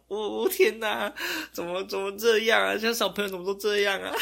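mock despair at kids these days, laughing through it; theatrical dismay rising into a laughing wail; exasperated groan. Exasperated about kids today.

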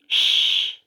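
long voiceless "shh"; urgent shushing. A narrator's reading. Tom grabs his comrade's arm in the graveyard, urgent shushing. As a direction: hush a friend with a drawn-out hiss. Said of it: "seized his comrade's arm and said".

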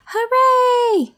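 high, loud hooray; cheering shout. Acted. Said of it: "both of them shouted".

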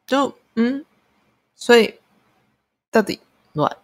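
halting, puzzled, with high-pitched starts; baffled. Real, not acted. Caller left unsoothed by the hotline, genuinely baffled. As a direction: grope for words in bewilderment, long pauses between. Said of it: "很疑惑啦".

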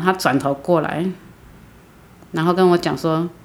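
usual speaking voice

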